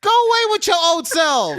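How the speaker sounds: loud, high, comic shooing yell; mock-furious dismissal. Man dismissing older coworker, comically outraged. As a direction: shout them away indignantly. Acted angry rant at coworker.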